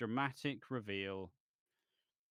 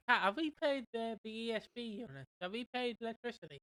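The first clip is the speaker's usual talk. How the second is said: pitched higher, a light send-up of Alfie asking; lightly voiced Alfie, higher pitch; mock Alfie voice; 8 semitones higher than usual. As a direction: voice Alfie fretting over the bill. Alfie asks Kat about bill.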